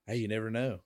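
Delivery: normal talking voice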